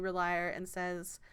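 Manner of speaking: ordinary talk, nothing marked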